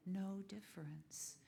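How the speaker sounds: a hushed, reverent echo; whispered echo. Shown as a demonstration. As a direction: breathe it back to them as a whisper. Echoing the Sufi saying softly.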